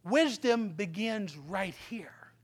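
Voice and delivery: slow, whispered emphasis; preacher whispering the key lesson. Shown as a demonstration. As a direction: breathe the words to the congregation. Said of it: "repeated as whisper".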